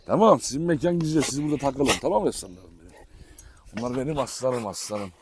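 ordinary talk

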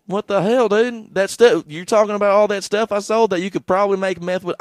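high, loud comic indignation; mock outraged protest. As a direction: protest loudly as the baffled convict. Acted protest at being sentenced.

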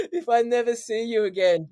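half-laugh, half-cry as he imitates mum; laughing mock tears. Son imitating mum's farewell, laughing mock-tearful.

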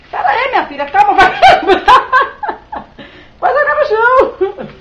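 laughing mom. Real, not acted. Mãe rindo segurando bebê. (rindo alto com a bebê)

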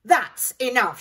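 loud forceful shout; heroic shout. A narrator's reading. Sue Potato shouting at the pea. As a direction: stop him with a shout. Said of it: "shouted Sue Potato".

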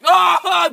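loud high yelp of disgust; disgusted shout. Real, not acted. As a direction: cry out at the taste. Tasting a bean on go.

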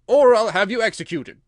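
very loud, high threat; threatening king. A narrator's reading. King threatens the forgetful Hatter with execution, loud and menacing. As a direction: threaten him at full voice.

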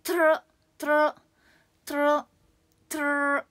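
トロロ with rolled r's. Shown as a demonstration. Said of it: "ルカロで終わることは".

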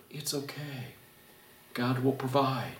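very soft, hushed calming; reassuring. Retold or imitated. Husband reminding his panicking wife, hushed and calm. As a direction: soothe her quietly. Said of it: "remind her".

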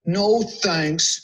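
lightly acted glum refusal. Buster refusing Carla's offer, mildly glum. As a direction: turn it down a bit sullenly. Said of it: "said Buster glumly".

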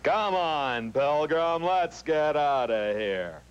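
cartoony John Wayne. Shown as a demonstration. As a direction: ham up the Duke calling to the pilgrim. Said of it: "John Wayne that, uh, is cartoony".

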